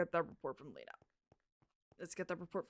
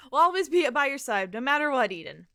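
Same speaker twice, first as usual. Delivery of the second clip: loud, high promise of support; raised, loud voice of loyalty; rallying pledge; 4 semitones higher, 11 dB louder than usual. Acted. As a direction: call it out to rally a friend. Friends pledging support in game.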